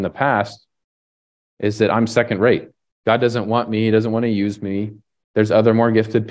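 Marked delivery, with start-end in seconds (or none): none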